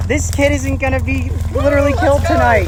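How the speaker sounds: high, loud relieved joke; relieved excitement. Real, not acted. Joking after the rescue, high and excited. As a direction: laugh off the danger, thrilled. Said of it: "I was very freaking excited".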